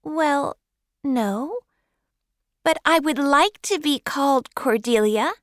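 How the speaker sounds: put-on high child's voice asking a wistful favour; hopeful girl. Girl asks Marilla to call her Cordelia, high and hopeful. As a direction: ask dreamily, like a child wishing. Girl admits, still hoping.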